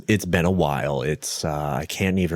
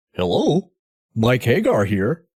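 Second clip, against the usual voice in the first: the mayor picking up the phone; Mike Hagar voice; 2 semitones higher than usual. Acted. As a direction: answer the phone like a tough action-hero mayor. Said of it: "my Mike Hagar voice".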